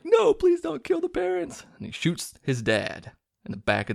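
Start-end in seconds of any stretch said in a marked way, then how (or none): 0.0-1.6 very high, strained mock sobbing plea; crying plea. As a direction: beg through a put-on high crying voice. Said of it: "he's crying like".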